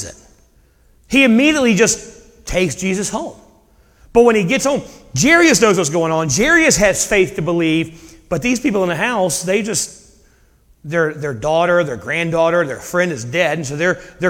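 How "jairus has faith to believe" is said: ordinary talk, nothing marked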